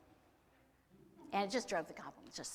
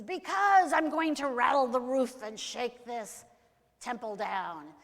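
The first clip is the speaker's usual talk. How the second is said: higher, loud, booming threat; threatening goblin king; 5 semitones higher, 13 dB louder, slower than usual. Acted. Goblin king threatens the temple, loud roaring menace. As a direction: roar it out, easing at the end.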